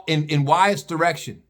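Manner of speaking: usual speaking voice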